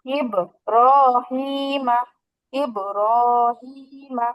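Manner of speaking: ইব, রহিমা stretched very slowly with long vowels; drawn-out recitation. Shown as a demonstration. Teacher reciting the example so students hear the madd, very slow. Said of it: "মাদের হরফও চলে আসছে".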